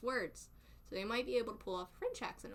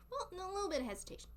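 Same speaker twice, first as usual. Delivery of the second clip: high, hurried correction; quick backtrack; 9 semitones higher, faster than usual. Real, not acted. Streamer softening reckless advice, high quick backtrack. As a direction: jump up high and rush to take it back.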